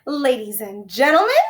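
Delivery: high, loud announcing voice; big deal announcement. A narrator's reading. Teacher announcing the halo winner to class, grand announcement. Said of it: "her special This Is a Big Deal voice".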